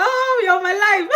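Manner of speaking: high, slow, loud laughing cry of thanks; laughing gush. Real, not acted. Vlogger thanks a viewer for the forgotten name, laughing shout.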